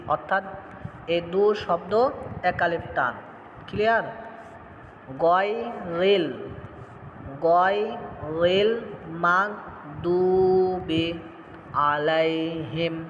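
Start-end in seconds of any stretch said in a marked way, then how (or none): 5.2-13.0 slow, drawn-out Quranic recitation. Shown as a demonstration. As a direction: recite it slowly for the students. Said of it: "teacher demonstrates the phrase". (ধীরে তিলাওয়াত)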